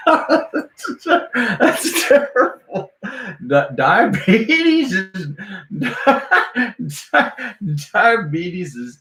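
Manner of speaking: laughing too hard to finish. Real, not acted. Laughing at own joke.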